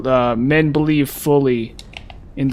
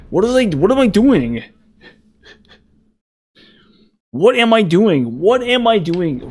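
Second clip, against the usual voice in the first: despairing whine; 6 semitones higher than usual. Real, not acted. Despair after dying.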